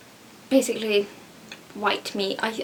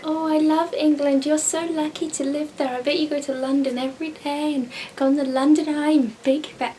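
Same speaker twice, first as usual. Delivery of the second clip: higher-pitched starry-eyed mimicry; mock gushing; 5 semitones higher than usual. Mimicking everyone who envies life in England, mock gushing. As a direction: imitate an over-keen admirer of England.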